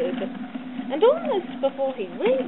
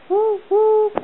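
high loud whoop whoop like a train whistle; whistle imitation; 9 semitones higher, 11 dB louder than usual. A narrator's reading. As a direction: call it out like an engine whistle.

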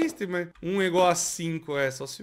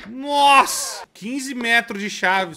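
shouting in disbelief; 5 semitones higher, 6 dB louder, slower than usual. Real, not acted. Gamer shocked at huge key.

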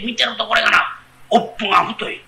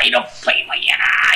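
awed whisper; 11 semitones lower than usual. Acted. Admiring the fox-woman.

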